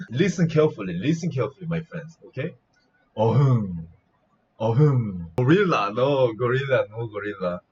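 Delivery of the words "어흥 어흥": spoken Korean lion roar; lion roar imitation. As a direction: imitate a lion with a falling growl word. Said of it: "This is a lion sound".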